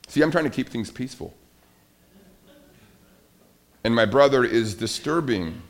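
ordinary talk

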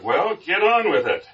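acted exasperated heckle; impatient shout. A narrator's reading. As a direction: shout at the auctioneer to hurry up. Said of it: "The man in the front row shouted".

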